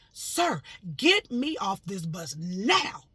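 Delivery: loud, slow, drawn-out demand; screaming. Retold or imitated. Demanding the bus driver let her off, screaming. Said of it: "I screamed at him".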